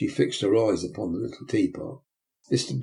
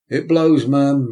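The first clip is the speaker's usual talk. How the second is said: higher and louder bluster; emphatic beadle; 5 semitones higher, 9 dB louder, slower than usual. Acted. Beadle to matron about the cold, higher and louder. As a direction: boom it about the wind.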